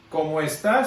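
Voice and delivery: "como está" with "está" rising on its last A; stress on está. Shown as a demonstration. Teacher showing what the phrase means without an accent, stress on está. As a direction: say "como está" as a statement, leaning on the final "tá" of "está". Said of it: "sin acento en la O y acento en la última A".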